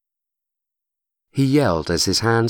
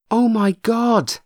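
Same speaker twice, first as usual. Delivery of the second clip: high, slow, stunned exclamation; shocked cry; 3 dB louder, slower than usual. A narrator's reading. Chrissy as the oven door blows off, stunned cry.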